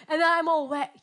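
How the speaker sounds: ordinary talk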